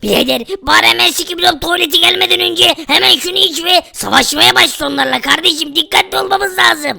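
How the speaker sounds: buddy character. Acted. Sidekick urging the hero to drink the potion, low voice. Friend character Fakir speaks.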